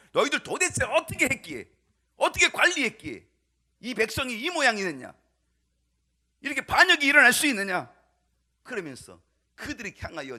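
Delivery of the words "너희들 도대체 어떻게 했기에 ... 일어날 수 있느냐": loud, high accusing outburst; blaming rebuke. Acted. David blaming his officials and advisers for the revolt, angry. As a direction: berate them, throwing the blame hard. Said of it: "책임을 돌리고".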